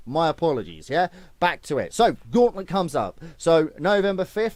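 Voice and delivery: normal talking voice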